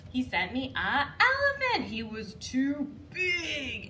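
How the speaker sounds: high, loud, very slow too big; storytime shout. Shown as a demonstration. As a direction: boom it out for a toddler. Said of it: "we kinda emphasize that".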